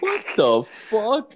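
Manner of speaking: slow incredulous cry; disbelief. Retold or imitated. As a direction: stretch it out in shocked disbelief. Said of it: "I'm like".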